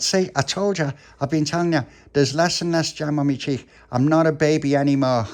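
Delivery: put-on high, loud protesting child; Tony, indignant. Tony complains about jam cheek.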